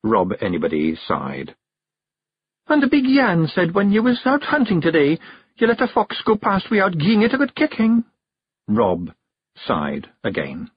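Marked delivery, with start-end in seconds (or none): 2.7-8.0 high Scots wife's voice, gently reproving; Scots wife, concerned. A narrator's reading. Jeanie reports Rob's slip at hunting, concerned reproof. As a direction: raise the pitch for a worried, nagging wife.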